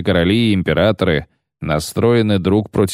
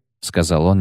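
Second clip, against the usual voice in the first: ordinary delivery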